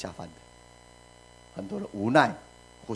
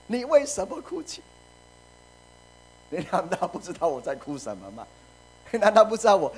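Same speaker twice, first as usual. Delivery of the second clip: 高声委屈地质问丈夫; aggrieved wife; 8 semitones higher than usual. Acted. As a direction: fire back at the husband, hurt. Preacher voices Hannah answering husband. (委屈的妻子高声反问)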